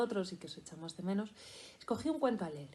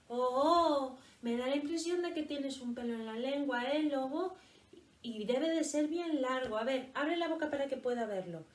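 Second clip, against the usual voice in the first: higher, lighter, cheeky voice; teasing rabbit; 4 semitones higher than usual. Acted. Clever rabbit teases the wolf about his tongue, higher and cheeky. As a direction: coax the wolf with mock concern.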